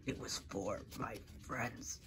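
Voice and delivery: breathy whispered vow; soft whisper of a vow for fallen friends. Acted. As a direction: hush it like a secret oath.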